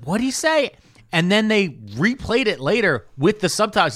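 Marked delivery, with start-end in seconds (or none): 0.0-0.7 high-pitched baffled "what'd he say"; baffled exclamation. Retold or imitated. Retelling hearing a swamp man, baffled and much higher. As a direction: pitch jumps way up, retold disbelief. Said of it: "I was like".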